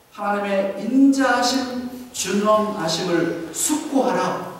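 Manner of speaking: slowly, weighing 인자하심 and 준엄하심을; slow emphatic verse. Acted. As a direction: let each word land. Repeating key verse slowly. (핵심 구절을 천천히 힘주어)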